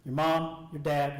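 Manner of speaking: ordinary delivery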